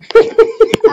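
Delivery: short, high burst of laughter; loud giggling outburst. Real, not acted. Player amused by teammates beating an opponent, high loud laugh.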